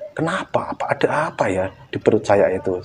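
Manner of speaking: soft unvoiced rising whisper; whispered question. Man checking his belly whispering in worry. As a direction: whisper it, puzzled.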